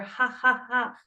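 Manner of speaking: deliberate, spaced-out ha-ha-ha; demonstrated mock laughter; fake laugh. Shown as a demonstration. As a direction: force out a pretend laugh. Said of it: "Fake it till you make it".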